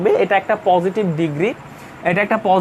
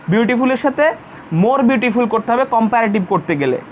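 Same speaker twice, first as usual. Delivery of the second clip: normal talking voice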